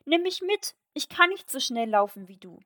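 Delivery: calling out. A narrator's reading. Frog begs the princess to take him along, calling out. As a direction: call after someone running off. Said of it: "rief der Frosch".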